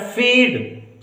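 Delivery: फीड with the ई slowly stretched; long ee demo. Shown as a demonstration. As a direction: draw out the ई in फीड. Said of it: "लंबा खिंचाव बड़ी की मात्रा में". (फीड में ई को धीरे लंबा खींचें)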